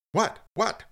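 squeaky startled double what; jump, caught off guard; startled Moses. A narrator's reading. Startled Moses answers the voice from the bush, high and surprised. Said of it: "said Moses".

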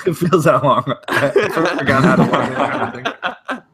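words squeezed out through real laughter; laughing too hard to finish. Real, not acted. Cohost laughing at how long the break was, laughing.

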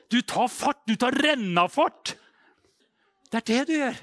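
high, loud urging; rousing preacher's shout. Real, not acted. Preacher urges believers to take a running start, calling loudly. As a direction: call it out like a charge.

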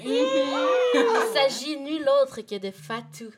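loud, slow, high game-show reveal; big announcer reveal. Acted. Host announcing guest to listeners, loud showbiz reveal. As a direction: belt out the guest's name like a presenter.

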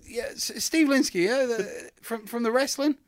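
high, puzzled caller voice, well above his own; confused promoter on the phone, lifted high; imitating the caller. Promoter on the phone realising the accent was fake, high puzzled voice.

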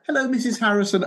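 high, halting polite hello; mock formal greeting. British fan imagining meeting Missus Harrison, high and proper.

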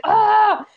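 high frustrated ah; frustrated little-sister cry. Retold or imitated. Little sister left at bus stop, frustrated squeal. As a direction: squeal of protest at being left. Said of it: "she'd be like".

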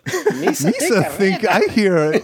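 high, slow goofy alien voice, half laughing; Jar Jar Binks. Acted. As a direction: read it as a squawky cartoon alien. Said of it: "How about Jar Jar Binks?".

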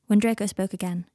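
plain reading voice

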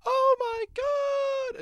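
very high shriek of horror; shrieking nurse finding the body; high horrified gasp; shocked scream. Retold or imitated. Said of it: "she's like".

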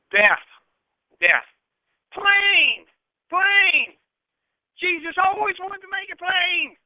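loud, emphatic pulpit cries; forceful preaching. Real, not acted. Preacher warning of evil and death, forceful. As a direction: punch out each short word, high and loud on "plain".

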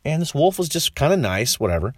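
ordinary delivery

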